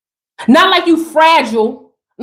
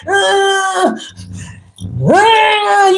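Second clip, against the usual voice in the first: high strained grunt; mock effort groan, drawn out; straining groan; 6 semitones higher than usual. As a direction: groan like holding up a heavy load. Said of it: "You over here like".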